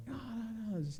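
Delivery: high, soft imitated sobbing no; retold breakdown. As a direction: mimic a girl falling apart, quietly. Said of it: "had a fucking breakdown".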